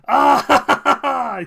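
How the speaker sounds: high, very loud "ah" breaking into laughter; explosive high laugh; loud laughing outburst. Real, not acted. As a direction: burst out in a big cackling laugh. Bursts out at chat's reaction.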